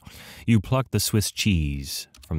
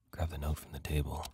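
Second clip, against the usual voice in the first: whisper; 4 semitones lower, 14 dB quieter, faster than usual. Pretend. Sneaking the note from the table.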